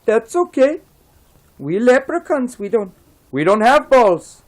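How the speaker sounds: squeaky leprechaun. Acted. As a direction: pipe it up like a little fairy man. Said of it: "And the leprechaun says".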